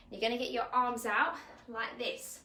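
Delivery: usual speaking voice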